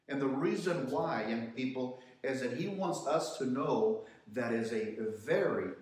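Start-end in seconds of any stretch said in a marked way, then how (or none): none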